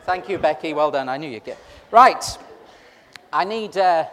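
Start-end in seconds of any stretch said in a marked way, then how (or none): none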